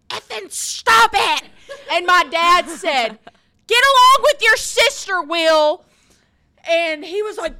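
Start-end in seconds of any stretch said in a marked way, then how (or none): 0.1-1.4 high, loud, slow outburst; angry brother yell. Retold or imitated. Brother cursing at Megan, shouted. 3.7-5.8 high loud commanding voice; dad's loud order. Retold or imitated. Dad telling Will to get along, loud.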